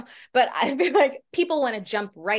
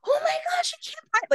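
much higher, gushing fan squeal; excited fan. Retold or imitated. Retelling how the star gushed over the merch. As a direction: imitate a giddy fan at the merch counter. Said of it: "she was so excited".

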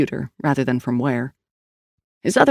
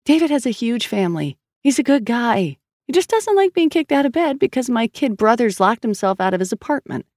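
higher-pitched, indignant big sister; exasperated defense; 6 semitones higher than usual. Acted. Sister defends her boyfriend to the brother who disturbed him, exasperated.

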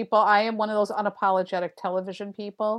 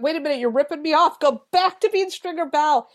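mock complaint; 6 semitones higher, 3 dB louder than usual. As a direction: whine it like a betrayed fan. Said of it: "I'm like".

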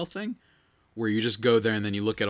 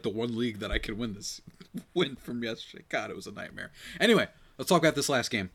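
usual speaking voice

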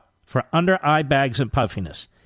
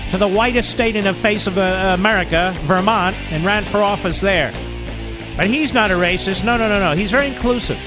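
mocking, raised sarcastic pitch; 8 semitones higher than usual. Real, not acted. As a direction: lay on the sarcasm thick. Sarcastic mock praise of Bernie.

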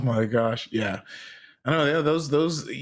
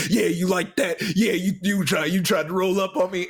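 mock taunting yell; 7 semitones higher than usual. Gamer yelling at video game characters, mock-combative. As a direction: taunt them as if they were real. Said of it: "I'm, like, yelling".

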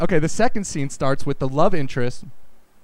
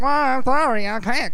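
Popeye voice; 7 semitones higher than usual. Retold or imitated. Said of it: "he talks like Popeye".

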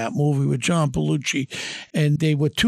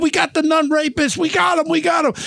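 high, jubilant shout; triumphant shout; 9 semitones higher than usual. Retold or imitated. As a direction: crow the news while hugging him. Shouting the news to Vinny.

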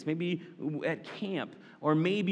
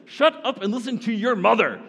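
higher, louder exasperated order; fed-up dad; 6 semitones higher, 6 dB louder than usual. Retold or imitated. Dad snapping at kids on a trip, mock stern. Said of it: "as a dad".

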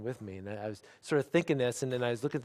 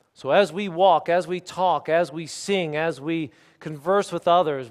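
loud, raised, ringing declaration; forceful preaching; 4 semitones higher, 8 dB louder than usual. Real, not acted. Preacher telling the standing church Jesus is with them, forceful preaching. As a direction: drive each phrase home to the congregation.

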